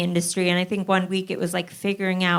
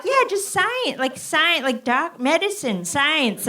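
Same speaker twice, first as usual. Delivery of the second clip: higher, eager classmate impression; mock classmate voice; 8 semitones higher, slower than usual. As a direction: play the clueless classmate, pitched up. Classmate shouting out Science.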